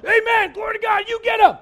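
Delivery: loud high mock cheer from the pews; shouted amen. Churchgoer cheering the preacher on politics, loud mock shout. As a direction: holler it like an eager churchgoer. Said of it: "somebody go".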